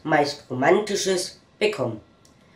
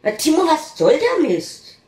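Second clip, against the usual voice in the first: annoyed macho; 10 semitones higher, 4 dB louder than usual. Acted. Macho snaps at his prompting friend.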